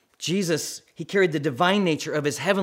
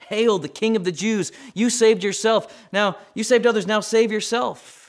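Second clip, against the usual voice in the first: higher, louder jeering taunt; mocking soldiers; 6 semitones higher, 4 dB louder than usual. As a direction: sneer it like the crowd at the cross. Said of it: "They're mocking him".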